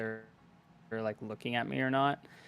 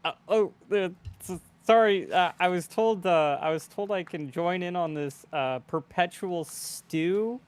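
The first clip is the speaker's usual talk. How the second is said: flustered apology; 6 semitones higher than usual. Acted. Player's character apologizing to the angry bartender for the fish. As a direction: stammer out a sheepish excuse.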